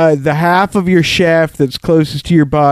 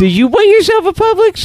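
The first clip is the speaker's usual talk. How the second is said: much higher laughing put-on voice; high silly voice. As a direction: squeal it through a laugh. Repeats the joke, laughing.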